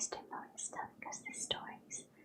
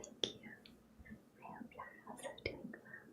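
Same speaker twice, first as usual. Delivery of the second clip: ordinary delivery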